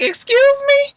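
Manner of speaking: very high mock indignation; offended surprise. [name] answering a rude remark, offended surprise. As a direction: pitch it way up as an affronted question.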